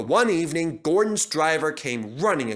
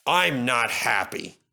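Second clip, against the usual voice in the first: ordinary delivery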